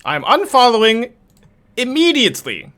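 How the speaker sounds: high, loud huffy threat; the chatter flounces off in outrage; Twitch chatter accent. Retold or imitated. Streamer voicing a chatter threatening to unfollow, high and loud. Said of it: "How dare you suggest that I sound like this?".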